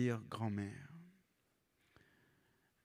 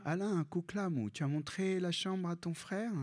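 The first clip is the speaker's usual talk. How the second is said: grandma; 7 semitones higher than usual. Retold or imitated. Grandma voiced in story.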